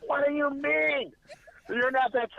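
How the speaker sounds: high, slow, disbelieving mom voice; a mother who can't believe what she hears; imitating his mom. Mom on the phone, stunned by her son's news, high and slow.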